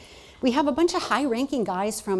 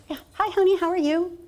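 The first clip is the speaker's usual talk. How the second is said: high, louder sing-song mom voice; mom's sweet greeting; 10 semitones higher, 6 dB louder than usual. Retold or imitated. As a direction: chirp it like a cheerful mother. Voicing her mom on phone.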